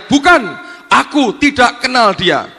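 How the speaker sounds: loud, high acted protest; acted outburst of denial; emphatic denial. Peter's denial acted while reading.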